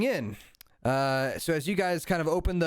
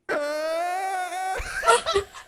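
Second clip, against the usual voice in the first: very high wailing screech; group cry. Acted. Three brothers answer the punchline together, long high wail. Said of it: "all three of them go".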